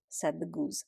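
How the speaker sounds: usual speaking voice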